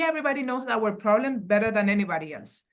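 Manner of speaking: ordinary talk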